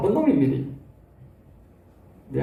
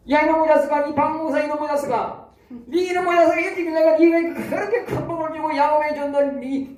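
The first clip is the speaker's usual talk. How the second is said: God scolding the pastor; 7 semitones higher than usual. Retold or imitated. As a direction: thunder down at him like an angry God. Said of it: "그런다니까".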